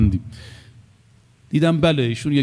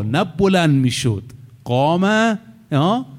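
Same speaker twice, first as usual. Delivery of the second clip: normal talking voice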